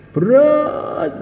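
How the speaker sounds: sharp high exclaim; heavy. Acted. Preacher voices companions' dread of Badar, high exclaim. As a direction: cry out the burden.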